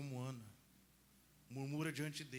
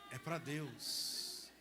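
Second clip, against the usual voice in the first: slow, weighty words; much slower than usual. Real, not acted. Priest telling women to cry out to God. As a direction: drag it out solemnly. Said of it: "slow emphatic delivery".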